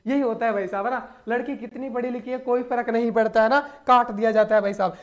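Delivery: usual speaking voice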